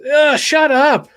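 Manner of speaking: loud, high, drawn-out groan of annoyance; annoyed outburst. Acted. Brandon cutting off the other kid, annoyed outburst. Annoyed character in read-aloud dialogue.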